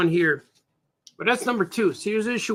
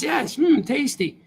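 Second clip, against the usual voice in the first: Mish savoring; 6 semitones higher, slower than usual. Acted. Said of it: "And she says".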